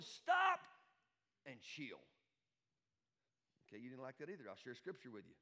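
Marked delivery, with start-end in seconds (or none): 0.0-2.0 shouted stop. Acted. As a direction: halt the worrying with one yell. The Lord interrupting worriers.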